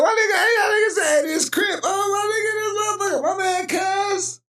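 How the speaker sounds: very high, squeezed, hysterical voice; crying with laughter. Real, not acted. Streamer repeating what viewers say, high tearful hysterics. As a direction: squeal the words, barely holding together. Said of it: "crying and laughing at the same time".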